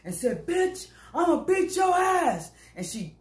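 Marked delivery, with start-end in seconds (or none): 0.5-2.5 high slow mock shout of a threat; big woman bellowing at a rival; retold threat. Bus passenger hollering a threat at another woman, mock shout.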